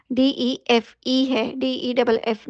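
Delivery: letters spelled slowly and distinctly; slow spelling out. Shown as a demonstration. Teacher points out the spelling to students, slowly. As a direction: spell it out, one letter at a time. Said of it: "spelling ज़रूर देखिएगा".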